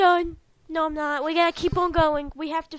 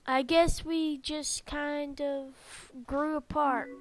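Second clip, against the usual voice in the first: soft, subdued confession; downcast game friend; 3 semitones lower, 5 dB quieter than usual. Acted. Friend explaining how they grew apart, softly downcast. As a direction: let the sadness sit quietly in it.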